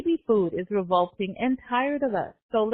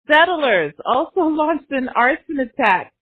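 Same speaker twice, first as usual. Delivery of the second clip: ordinary delivery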